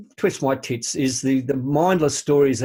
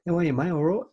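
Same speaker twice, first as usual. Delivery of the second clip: high-pitched mock English accent; 6 semitones higher, faster than usual. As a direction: mimic an English footballer's higher voice. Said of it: "that's the accent".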